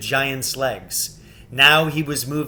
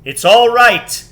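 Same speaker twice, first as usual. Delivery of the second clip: loud, higher joyful call; joyous shout; 8 semitones higher, 12 dB louder than usual. Acted. Aslan calling out to reassure the children, joyous shout. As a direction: boom it out with delight. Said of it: "shouted Aslan joyously".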